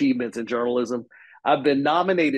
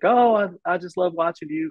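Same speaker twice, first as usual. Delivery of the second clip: higher-pitched gushing fan quote; older lady fan; 6 semitones higher, 4 dB louder than usual. Retold or imitated. Older lady emails the reporter, lightly voiced admiring fan. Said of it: "this older lady once who's like".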